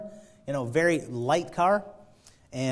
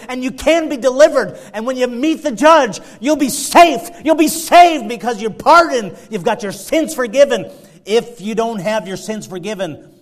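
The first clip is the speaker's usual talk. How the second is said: loud, higher fervent preaching; full-force preaching; 8 semitones higher, 16 dB louder than usual. Acted. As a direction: press the call on the congregation.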